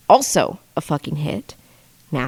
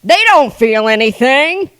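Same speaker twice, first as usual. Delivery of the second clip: high, loud, slow mock dismissal; hammed-up smug relatives brushing her off; mocking family quote; 8 semitones higher, 9 dB louder than usual. Mimicking family who mocked her crying, high and loud. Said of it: "And then were like".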